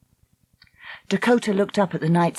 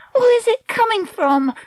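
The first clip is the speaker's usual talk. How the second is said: frightened; 4 dB louder than usual. A narrator's reading. Girls frightened by hissing.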